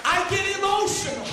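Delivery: high, shouted, half-sung whoop; preaching at full force. Acted. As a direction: lift the congregation with every line. Whooping sermon climax.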